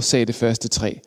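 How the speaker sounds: ordinary delivery, nothing marked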